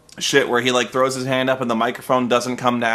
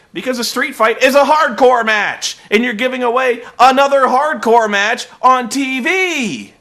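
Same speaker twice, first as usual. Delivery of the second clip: exasperated loud rant; 8 semitones higher, 6 dB louder than usual. Real, not acted. Ranting about booking choices.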